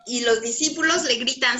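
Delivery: ordinary talk, nothing marked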